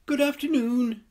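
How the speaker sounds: polite little bear speaking up, high and clear; tiny well-mannered bear greeting strangers; small clear bear voice. Acted. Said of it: "it said in a small, clear voice".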